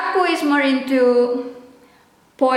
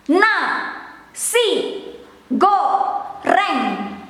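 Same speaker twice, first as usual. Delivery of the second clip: "Na, si, go, reng" split into separate syllables, each clipped short; staccato; much slower than usual. Shown as a demonstration. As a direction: say "Na, si, go, reng" one syllable at a time. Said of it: "I will pronounce it in Indonesian".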